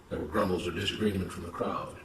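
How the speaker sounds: usual speaking voice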